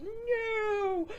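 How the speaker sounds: high, drawn-out mock "no"; mock anguished cry. Recapper mimics horror at the lost gold, mock anguished cry. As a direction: wail it in mock despair.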